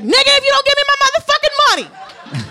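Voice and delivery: high, loud furious yell, half laughing; retold yell. Demanding her pay from the dealer, a high furious yell. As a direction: scream the demand for the money.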